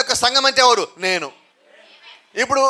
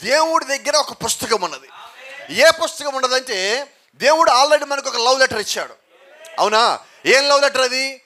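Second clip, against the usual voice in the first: ordinary talk, nothing marked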